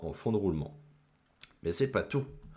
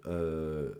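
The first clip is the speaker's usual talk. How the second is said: ordinary delivery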